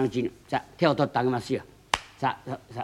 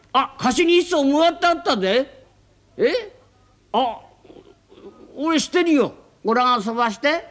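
excited claim; 12 semitones higher, 6 dB louder, slower than usual. Acted. Excited exchange about a boat.

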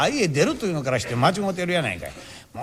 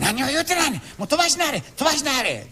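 urging shamisen player; 7 semitones higher than usual. Retold or imitated. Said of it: "おっしゃんが".

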